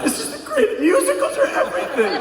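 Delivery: overjoyed theatre convert; gushing high. Retold or imitated. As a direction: swoon over the musical in a high voice. Gushing about the musical, converted.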